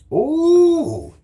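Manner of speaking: squealing high ooh; a sudden high falsetto squeal; mock-impressed squeal. Real, not acted. Reacting to chat.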